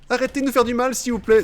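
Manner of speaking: high, loud begging; high pleading victims. Acted. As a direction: plead to stop the beating.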